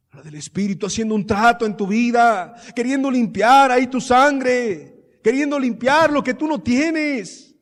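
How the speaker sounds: impassioned preaching. Real, not acted. Preaching builds up.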